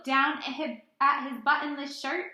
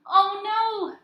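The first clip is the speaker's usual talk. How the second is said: higher, louder playful oh no; mock alarm; 9 semitones higher, 7 dB louder than usual. A narrator's reading. Teacher reading aloud, playful loud higher dismay. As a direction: act out mock dismay for the kids. Button lost in the story.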